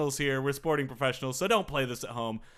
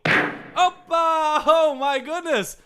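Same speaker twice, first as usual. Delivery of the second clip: high, loud exclamation; excited cheer; 12 semitones higher, 7 dB louder, much slower than usual. Real, not acted. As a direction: cheer it out, then marvel slowly. Cheering at the outcome.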